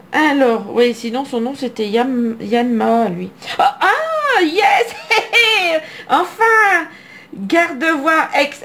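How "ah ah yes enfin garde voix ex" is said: high delighted shriek with laughter; real joy. Real, not acted. Collector finally pulling the card she wanted, joyful shout. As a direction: burst out with relief and glee.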